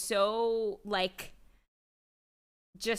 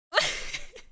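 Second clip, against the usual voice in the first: mock cry; 13 semitones higher, 3 dB louder than usual. Mimics crying.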